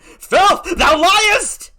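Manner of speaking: very high, loud, slow bellow; husband screams at his wife, full force; savage shout. Acted. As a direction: spit it at her. Iago attacks wife.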